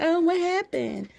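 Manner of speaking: high, coy mock question; giggly innocence. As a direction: play it giggly and clueless. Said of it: "I'm all giggling and shit".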